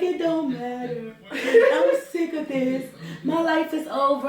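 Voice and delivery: slow, hammed-up sobbing whine; mock crying. Crying cousin refusing comfort, mock sobbing. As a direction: drag it out in a melodramatic wail. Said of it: "She's still crying".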